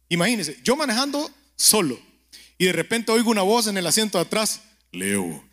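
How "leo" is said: low, soft call of one name from the back seat; a low, hushed divine call; deep voice of God. Pretend. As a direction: call the name like an unseen voice in the dark.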